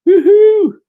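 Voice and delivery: loud high whoop of joy; excited cheer. Real, not acted. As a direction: cheer out at the good news. Cheering at subscriber count.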